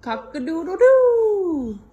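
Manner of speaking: loud, high, drawn-out rooster crow. Acted. Mother demonstrates rooster call.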